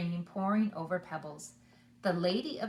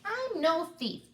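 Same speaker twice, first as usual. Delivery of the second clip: higher, slow protest; indignant boy; 10 semitones higher, slower than usual. A narrator's reading. Jack protesting to the giantess, higher and slow. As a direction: insist you're no thief. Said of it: "Jack said".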